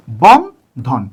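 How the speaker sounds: "Bandhan" as a rising বন, a pause, then a low ধন; split into syllables. Shown as a demonstration. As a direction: break "Bandhan" into two spaced syllables. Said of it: "তাহলে অক্ষর বিন্যাস কি হবে". ("বন্ধন" থেমে দুই অক্ষরে, বন... ধন)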